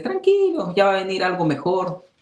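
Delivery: high, hammed-up soothing "Tranquilo"; sing-song fake reassurance; mock consoling. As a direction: overdo the comforting pat on the back. Consoling a friend who lost job.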